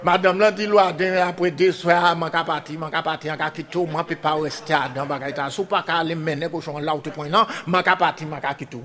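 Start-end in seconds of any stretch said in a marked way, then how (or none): 2.2-8.8 low soft repeated complaint; lightly voiced weary wife, soft; quiet lament. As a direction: murmur it, fed up and leaving. Wife says she is leaving.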